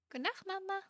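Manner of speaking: high, soft, lightly voiced little character; put-on voice. Streamer voicing a goodnight to Mama in a small high voice.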